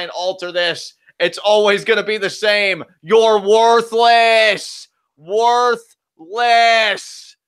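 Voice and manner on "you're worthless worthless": slow, loud, drawn-out taunt. Acted. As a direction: spit it at the screen, slowly. Taunting the wrestler.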